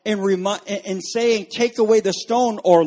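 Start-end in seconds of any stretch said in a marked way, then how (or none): 1.5-2.6 firm order, a little louder and higher; voicing a strong order; authoritative command. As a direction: quote a commanding voice. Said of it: "with a strong and authoritative voice".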